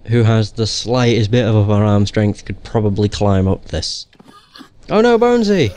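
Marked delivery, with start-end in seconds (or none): 4.9-5.7 high-pitched playful cry of dismay; hammed-up "oh no", pitched way up; mock alarm. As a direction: cry out in pretend horror. Mock alarm as skeleton falls apart.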